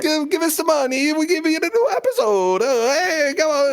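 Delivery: very high, whiny cartoon Italian accent. Podcaster begging listeners for money, Italian accent. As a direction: plead in a squeaky mock Italian accent. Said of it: "Italian accent".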